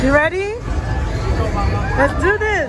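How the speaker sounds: high, bright encouragement. Real, not acted. Cheering child on.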